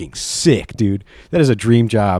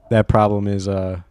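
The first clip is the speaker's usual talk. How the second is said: ordinary delivery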